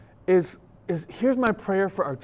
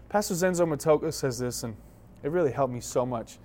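ordinary delivery

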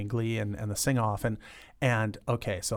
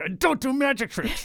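mock high protest; 14 semitones higher, 3 dB louder than usual. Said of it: "And I was like".

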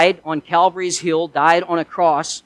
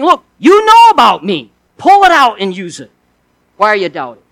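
loud, urgent Jesus pressing the disciples; a preacher booming Jesus' challenge; Jesus urging; 3 semitones higher, 8 dB louder than usual. Acted. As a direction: shake them awake with a raised voice.

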